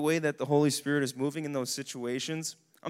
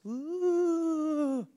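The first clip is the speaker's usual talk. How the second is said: high, slow swooning "ooh" of false emotion; mock gushy feeling; 14 semitones higher than usual. Preacher mocking churchy warm fuzzies, high and swooning. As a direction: parody a gushy spiritual high.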